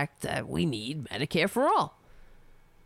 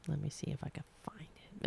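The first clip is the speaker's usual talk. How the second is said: whispered, much softer, under his breath; muttering to himself; 4 semitones lower, 15 dB quieter, faster than usual. Real, not acted. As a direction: mumble while scrolling. Muttering while searching tweets.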